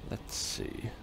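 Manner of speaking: soft whisper to self. Real, not acted. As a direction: murmur it. Thinking aloud over map.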